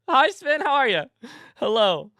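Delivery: high, loud burst of hellos; excited greeting. Real, not acted. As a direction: greet them with over-the-top enthusiasm. Greets a subscriber by name, hammed up.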